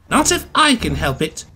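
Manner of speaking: high, huffy retort; put-out little engine digging in. A narrator's reading. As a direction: huff it out stubbornly. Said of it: "Percy huffed".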